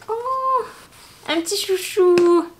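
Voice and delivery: high, drawn-out delighted coo. Real, not acted. Vlogger finding a cute scrunchie, high delighted cooing. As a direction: coo slowly over something cute.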